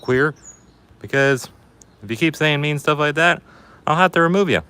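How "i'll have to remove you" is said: ordinary delivery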